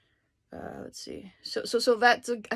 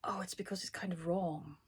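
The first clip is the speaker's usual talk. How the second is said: whispered aside; 8 dB quieter than usual. Real, not acted. Thinking aloud, muttered aside.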